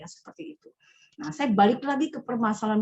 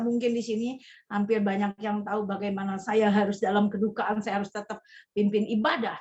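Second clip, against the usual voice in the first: usual speaking voice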